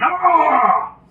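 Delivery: high scream while running. Retold or imitated. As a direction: shriek in panic.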